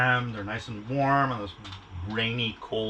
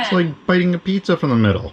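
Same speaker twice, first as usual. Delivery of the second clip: high, loud indignant question; mock outrage; 8 semitones higher, 10 dB louder than usual. Real, not acted. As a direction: act scandalised at her. Continues teasing outrage.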